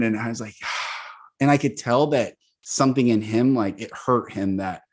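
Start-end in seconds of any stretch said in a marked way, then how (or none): 0.6-1.1 soft breathy exhale; quiet, voiceless outbreath; acted sigh. Retelling his reaction to reproach.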